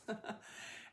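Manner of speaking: a quick amused laugh; light laugh. Real, not acted. Laughing at own words.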